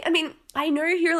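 ordinary delivery

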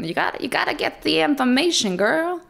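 a light, quick Southern accent imitating dad. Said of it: "like, Southern".

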